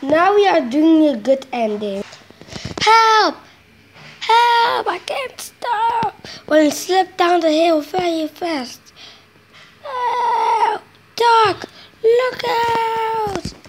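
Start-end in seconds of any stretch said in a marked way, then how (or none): 2.8-6.2 loud, high cries for help; shouted help. Acted. Engine runs away downhill. 9.8-13.5 high, crying call; crying warning. Acted. Engine warns Doc.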